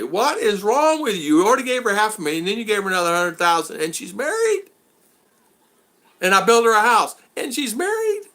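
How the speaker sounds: high, rising to very high on married; incredulous rant. Retold or imitated. Listing the man's gifts, high incredulous rant. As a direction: pile on the disbelief. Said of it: "Like that".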